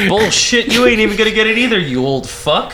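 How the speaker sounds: high fast mock yell. Mock yelling at old man.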